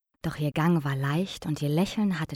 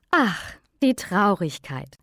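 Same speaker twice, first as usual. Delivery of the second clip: slow, louder, glad greeting; delighted exclamation; 3 semitones higher, 5 dB louder, slower than usual. A narrator's reading. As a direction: greet her like an old friend. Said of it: "rief die kleine Frau erfreut aus".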